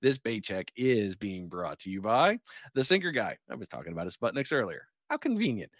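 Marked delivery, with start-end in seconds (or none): 5.1-5.7 high, mock-surprised aside; sarcastic. Real, not acted. Host teasing his own sponsor plug, mock surprise. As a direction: wink at the sponsor tie-in.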